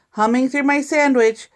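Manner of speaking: usual speaking voice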